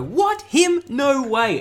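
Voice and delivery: high-pitched, exaggerated astonishment; mock disbelief. Comedian mimicking people hearing someone is exposed, mock disbelief. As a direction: act utterly floored, starting very high and dropping.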